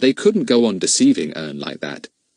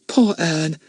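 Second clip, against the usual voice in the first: put-on higher girl's voice, pitying; Betts pitying; 8 semitones higher than usual. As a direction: Betts pities Ern in a high girl's voice. Betts pities Ern.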